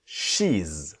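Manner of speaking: "she's" said as a single syllable with a buzzing z; one quick beat on "she's". Shown as a demonstration. Teacher modelling "she's" in one syllable for students. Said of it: "One syllable".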